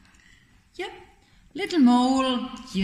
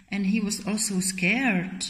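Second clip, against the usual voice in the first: plain reading voice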